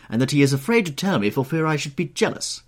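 high, loud, teasing hostess; crying out. A narrator's reading. Hostess teasing a guest about jealousy, exclaiming. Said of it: "cried Lady Narborough".

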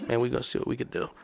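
low, very soft, quick murmur; quiet, confidential retold voice; hushed reply. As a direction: answer discreetly under your breath. Worker's hushed reply to whispered question.

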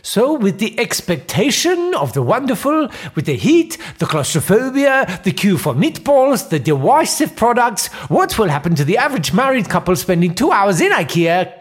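executive meeting in a put-on Swedish accent; comic Swedish boardroom voice, rising pitch. Mister Carlson pitching strategy in a Swedish accent. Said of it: "do a funny foreign accent".

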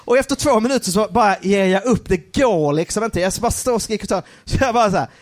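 usual speaking voice

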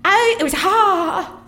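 high, drawn-out stage wail; overwrought monologue. Acted. Actress describing hot dream desert, overwrought delivery. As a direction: pitch it high and stretch the vowels.

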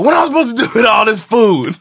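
high, loud, incredulous shout; mock outrage at too much food; incredulous beggar. Beggar shouting at the sister over too much food. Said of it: "He's like".

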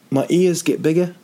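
usual speaking voice

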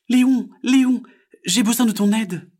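high, loud call for help; little frog calling. Acted. Frog calls heron for help, high and loud.

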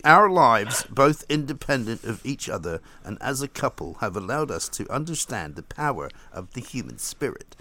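hybrid earnest royal couple; Harry and Meghan voice. Retold or imitated. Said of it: "amalgamated voice of both of them".

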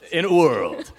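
loud laughing movie trailer intro; trailer voiceover. Shown as a demonstration. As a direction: boom it out like a trailer announcer, laughing. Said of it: "my trailer voiceover".

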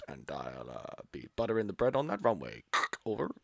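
low, soft pompous captain announcement; pilot voice. Retold or imitated. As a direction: announce like a fake airline captain. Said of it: "practicing his pilot voice".

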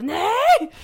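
sudden high, loud yell of no; explosive competitive shout of no. Retold or imitated. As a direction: burst out with a full-voice shout. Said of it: "jag skriker rakt ut".

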